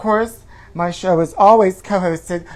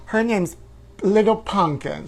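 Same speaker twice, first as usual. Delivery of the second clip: normal talking voice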